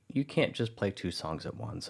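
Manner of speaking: ordinary delivery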